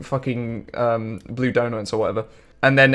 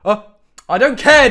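excited; 10 semitones higher, 6 dB louder than usual. Real, not acted. Reacting to a pull.

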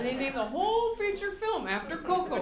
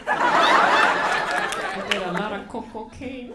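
slow, much louder, laughing; Mama Coco punchline; 9 dB louder, much slower than usual. Pretend. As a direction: play the old lady boasting of wild days.

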